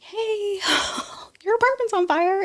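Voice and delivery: high, breathless alarm as she breaks the news; urgent phone call. Retold or imitated. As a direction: squeak it out high with a nervous laugh. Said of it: "called me and was like".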